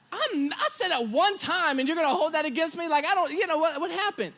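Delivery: mock-defensive sputter; indignant protest. Indignant protest at being quoted.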